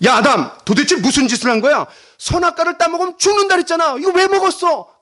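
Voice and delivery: loud, high accusatory scolding; acted angry interrogation, building in pitch; furious cornering. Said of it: "그러면서 막 몰아세웠습니까?".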